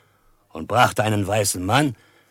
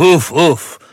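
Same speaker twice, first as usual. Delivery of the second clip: higher, louder double exclamation; excited uff uff; 8 semitones higher, 8 dB louder than usual. Acted. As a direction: cry out in eager approval. Impressed by Old Shatterhand's insight.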